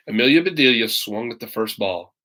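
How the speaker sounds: usual speaking voice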